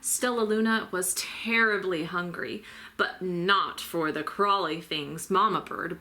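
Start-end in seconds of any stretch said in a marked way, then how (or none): none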